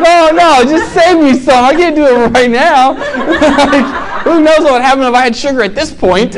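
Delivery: high, laughing aside to friends. Real, not acted. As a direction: brush off the snack offer while laughing. Offstage snack offer, laughing.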